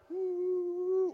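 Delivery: high, airy ooh of temptation; a high little ooh as she reaches for a bite; wife's cooing ooh. Retold or imitated. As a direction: coo like she's eyeing the cake. Wife eyeing his dessert.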